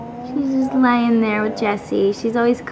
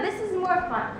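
usual speaking voice

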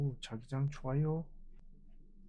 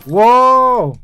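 loud startled shout; surprised shout; 10 semitones higher, 21 dB louder, much slower than usual. Real, not acted. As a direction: yell out in sudden shock. Sudden enemy appears.

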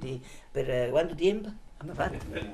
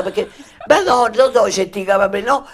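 ordinary talk, nothing marked

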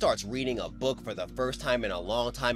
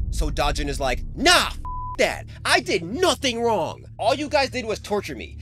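higher, louder furious defiance; snapping back; 6 semitones higher, 5 dB louder than usual. Acted. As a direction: let it all burst out at the bullies. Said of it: "what Da Jun needed to snap".

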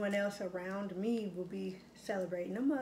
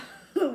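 loud burst of laughter at the memory; laughing at a fond story; 8 semitones higher, 10 dB louder than usual. Real, not acted. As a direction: let a big laugh out.

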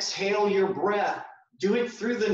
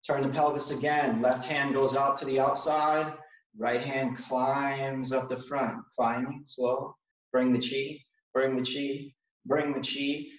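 guided exercise cues; 4 semitones lower than usual. Acted. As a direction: guide them gently through each movement. Guides a slow qigong movement sequence.

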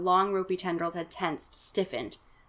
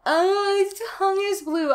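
surprised; 13 semitones higher, 4 dB louder than usual. Real, not acted. Reader noticing a blue tongue.

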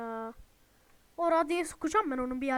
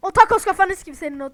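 angry cursing; 5 semitones higher, 18 dB louder, faster than usual. Real, not acted. Cursing the monster in game.

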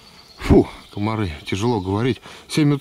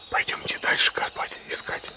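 breathy voiceless whisper; wildlife whisper; 8 dB quieter than usual. Real, not acted. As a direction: hush it for the birds. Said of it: "Шёпотом говорю, чтоб птиц не перебивать".

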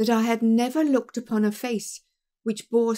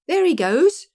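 sudden loud cry, pitch jumping up; 8 semitones higher, 8 dB louder than usual. A narrator's reading. Hall Pycroft spotting the man, crying out. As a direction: call out as the man vanishes. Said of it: "cried Hall Pycroft".

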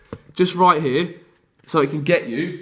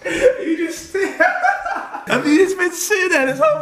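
high squeaky laugh through words; giggling while asking; 11 semitones higher, slower than usual. Real, not acted. Dad laughing at trapped son.

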